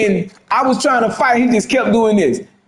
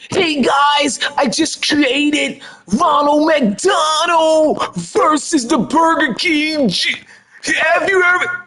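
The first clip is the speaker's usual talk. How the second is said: high, slow, drawn-out mock announcer voice; hammed-up YouTuber intro, stretched out; mock hype streamer; 7 semitones higher, slower than usual. Mocking the game maker's hype video, high and slow.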